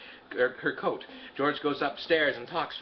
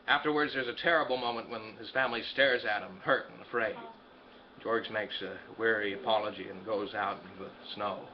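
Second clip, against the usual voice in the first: ordinary delivery, nothing marked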